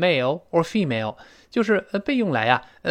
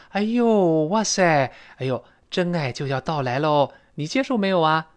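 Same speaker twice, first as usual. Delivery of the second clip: happy for her; 2 semitones higher than usual. Acted. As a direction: gush with joy for a friend. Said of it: "第二个女孩子很高兴哦". (替朋友高兴地惊呼)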